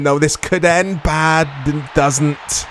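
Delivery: ordinary delivery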